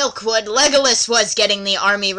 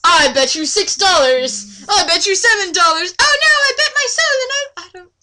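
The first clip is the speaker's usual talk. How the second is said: put-on higher, louder childish betting voice; squeaky high kid pushing up in pitch; kid's card game voice; 8 semitones higher, 5 dB louder than usual. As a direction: play the bickering kid betting dollars. Acted card game between kids.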